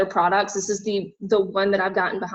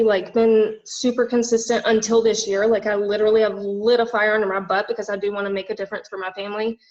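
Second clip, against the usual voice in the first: ordinary talk, nothing marked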